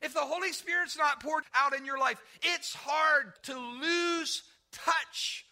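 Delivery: loud, slow climactic preaching; full-force preaching. Acted. Preacher urging the church to let go, loud preaching. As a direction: press the point home loudly.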